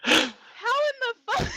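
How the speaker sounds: high, loud question breaking into laughter; laughing bewilderment. Retold or imitated. Drunk girl at the slope's bottom, bewildered laughing. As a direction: re-enact disbelief while cracking up. Laughing at her muddy fall.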